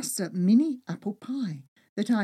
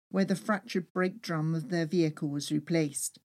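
plain reading voice

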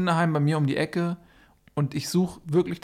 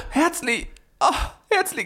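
shocked gasp; 13 semitones higher than usual. Acted. Discovers his teddy bear.

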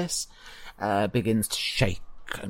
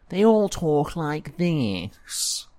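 higher, very slow mocking whine; whiny; 4 semitones higher, much slower than usual. Shown as a demonstration. Game master mocks his own character voices, whiny. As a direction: drag the words out in a whine. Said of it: "turned really whiny in their voices".

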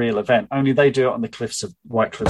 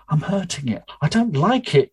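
mild yell; 7 semitones higher than usual. Acted. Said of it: "yelled Faye".

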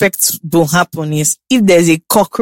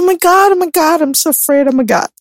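high, hammed-up fright; fake scared; 9 semitones higher than usual. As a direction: act scared of thunder on purpose. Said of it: "This thing that I was saying".

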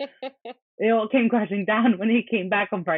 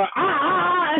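put-on toddler teasing; 9 semitones higher, slower than usual. Co-host mimics toddler with the keys.

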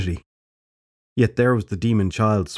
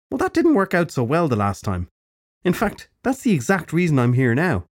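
put-on high, bright-edged old witch; old crone voice; 8 semitones higher than usual. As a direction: speak as a brisk old crone. Crone character voice.

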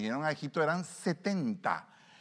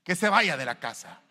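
mock pastor order; 5 semitones higher, 9 dB louder than usual. Blunt pastor voiced.

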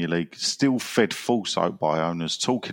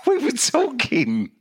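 amused disbelief. Real, not acted. Incredulous laughing reminder to co-host.

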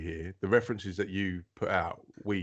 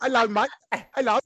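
louder, higher, hammed-up British accent; a cheeky British greeting, louder and higher; put-on British accent; 7 dB louder than usual. Teasing friends by hamming up a British accent. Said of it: "you got a real Moon Knight accent going there".

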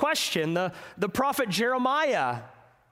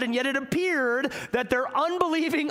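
normal talking voice

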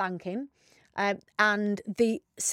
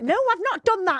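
high, louder mock indignation; indignant denial; 12 semitones higher, 5 dB louder than usual. Telling friends how she denied it to the caller, indignant. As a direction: retell a protest, pitched well up.

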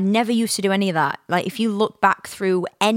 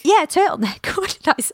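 high giggly question through laughter; giggling in disbelief at herself; laughing; 11 semitones higher than usual. Real, not acted. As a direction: laugh at your own slip. Laughing at her own word slip.

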